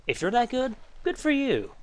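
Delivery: high-pitched teasing good for you; mock congratulation. Real, not acted. Teasing viewers who are good.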